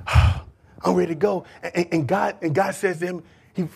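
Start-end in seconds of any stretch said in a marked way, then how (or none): 0.1-1.5 weary despair. Acted. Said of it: "He's thinking to himself".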